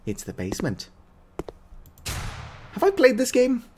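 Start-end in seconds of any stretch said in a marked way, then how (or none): 2.7-3.6 surprised. Real, not acted. Sudden recognition of the game.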